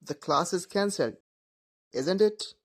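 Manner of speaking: isn't it going up, asking; rising tag. Shown as a demonstration. Student unsure class is cancelled, rising tag. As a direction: lift isn't it as a real question. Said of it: "not completely sure".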